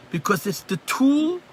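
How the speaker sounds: "tool" drawn out slowly and pitched high; stress on tool. Shown as a demonstration. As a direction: lean hard on the word "tool", slowly. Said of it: "The emphasis on the word tool".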